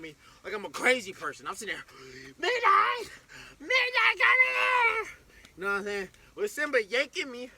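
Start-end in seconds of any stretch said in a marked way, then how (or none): 2.4-5.1 high, loud shout of the name; shouting for the dog. Retold or imitated. Owner shouting after runaway dog Midnight, loud and high. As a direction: yell after a dog far away.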